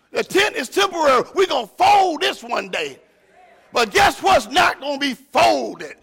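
loud, high shouted preaching; fiery high-pitched sermon shout; preaching at full force. Real, not acted. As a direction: preach it out to the back pew. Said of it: "So if I get a little excited".

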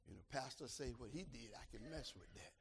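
ordinary talk, nothing marked

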